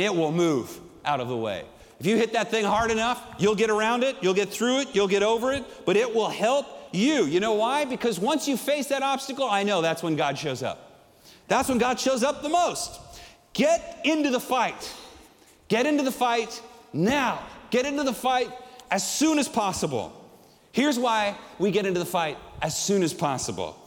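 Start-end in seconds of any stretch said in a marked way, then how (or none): 2.0-13.0 rising, driving sermon build; preaching at full force. Acted. Preacher driving the congregation toward courage, building. 13.5-23.8 rally them, hammer each repeat; shouted exhortation. Real, not acted. Preacher urging listeners into the fight, shouting.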